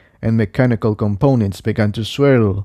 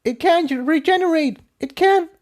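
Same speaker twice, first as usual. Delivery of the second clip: much higher voiced mare, urgent; Incendia alarmed. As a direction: insist in disbelief.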